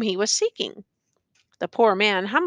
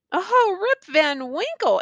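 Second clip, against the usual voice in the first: high, drawn-out exclamation of recognition; 9 semitones higher, slower than usual. A narrator's reading. Bystanders recognise the name, exclaiming. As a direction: exclaim it slowly, pitched high, falling away. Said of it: "exclaimed two or three".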